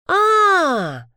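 long, high "aah" of recognition; drawn-out happy cry as it dawns on you; high falling exclamation of delight; delighted realisation. A narrator's reading. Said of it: "Послышался радостный смех".

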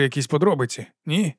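ordinary delivery, nothing marked

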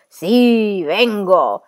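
loud, swooping howl of the flood. A narrator's reading. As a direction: howl it out like roaring water. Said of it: "anch'esso ululando". (ululato forte e minaccioso)

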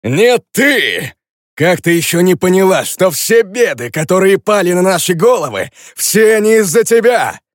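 high, loud mocking villain voice; escalating comedy rant. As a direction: sneer it out, rising and gloating. Zak accuses Fran.

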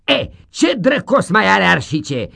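high, loud demanding teacher; loud gruff schoolmaster asking; loud schoolmaster question. Acted. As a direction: bark the question at the class. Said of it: "întreba domnul Vucea".

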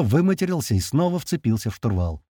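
ordinary delivery

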